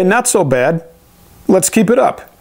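ordinary delivery

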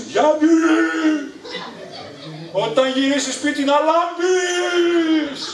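high, slow, drawn-out shout with a laugh; mother shouting a threat from the balcony, slow and high. Retold or imitated. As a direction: yell it across the whole neighbourhood as a warning. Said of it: "βγήκε και φώναξε".

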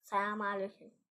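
ordinary delivery

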